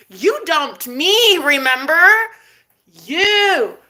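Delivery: high, drawn-out accusing retort; pointed sass. Retold or imitated. Woman reminding ex who dumped her, pointed sass. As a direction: hammer the reminder home, stretching it.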